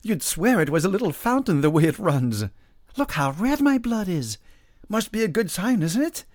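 high, loud peasant voice; eager, high-pitched amazement; excited marvelling. A narrator's reading. Peasant marvels at his blood.

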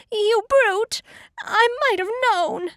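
high, loud pig outburst; indignant cry. A narrator's reading. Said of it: "Pig cried".

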